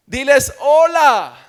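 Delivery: ordinary delivery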